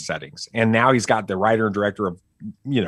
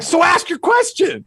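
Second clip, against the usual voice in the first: high and louder, fed-up dad snap; mock exasperated dad; 6 dB louder than usual. Dad telling his son to ask, mock exasperated and high. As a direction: toss it back like a fed-up father. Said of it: "I'm like".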